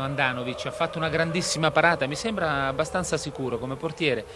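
ordinary delivery

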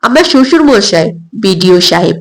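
plain reading voice

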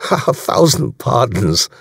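German commander laughing an apology; amused, slow apology with a German accent. A narrator's reading. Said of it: "said von Brunning, laughing".

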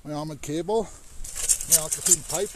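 ordinary delivery

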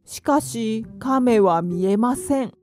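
plain reading voice

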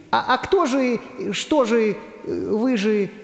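high, halting, puzzled voice; hesitant stutter, pitched high; doubtful stammering. Acted. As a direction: stumble over the question in doubt. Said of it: "засомневался Карандаш".

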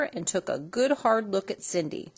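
plain reading voice

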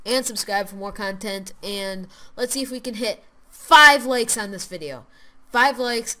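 loud, upbeat YouTube outro voice. Acted. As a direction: sign off big and cheerful to the viewers. Loud cheerful outro to viewers.

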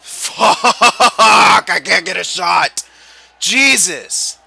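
real high, very loud outburst; frustrated shout. Real, not acted. Gamer who can't get a shot, frustrated shouting. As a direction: explode in frustration at the game. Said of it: "I'm actually getting frustrated".

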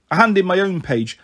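usual speaking voice

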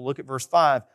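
ordinary delivery

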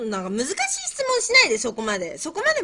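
high, loud complaint; protesting plea. Real, not acted. Pleads against hard quiz questions. (高い声で困って訴える)

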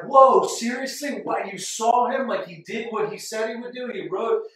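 high, loud and slowed in wonder; Thomas's imagined reaction, loud high amazement; amazed disbelief. Retold or imitated. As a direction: gasp it out like a thrilled friend. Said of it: "it's not like".

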